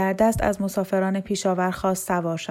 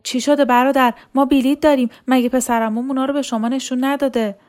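higher, louder, anxious pleading; numb with fear; 5 semitones higher, 5 dB louder than usual. A narrator's reading. Laila asking the soldier what is wrong, higher and frightened. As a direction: plead with the soldier, scared but holding on.

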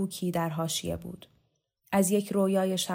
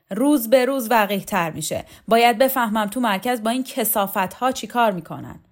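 indignant complaint; 3 semitones higher, 7 dB louder than usual. Acted. Shokat complains to Roxana.

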